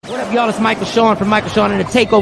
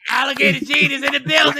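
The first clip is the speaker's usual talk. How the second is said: hype intro; 6 semitones higher, faster than usual. Pretend. Announcing a guest.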